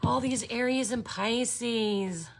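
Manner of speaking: normal talking voice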